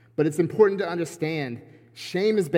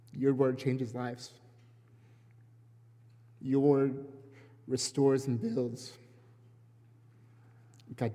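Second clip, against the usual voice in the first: prayer voice; 4 dB quieter than usual. Acted. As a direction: pray it gently and sincerely. Prayer before sermon.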